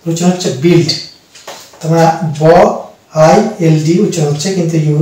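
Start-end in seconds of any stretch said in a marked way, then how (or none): none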